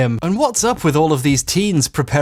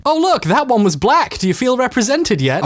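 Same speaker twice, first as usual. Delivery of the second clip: high-pitched, sarcastic cheer; mock delight; 8 semitones higher than usual. Critic mocking the show's token representation, falsely delighted. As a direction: feign delight with obvious sarcasm.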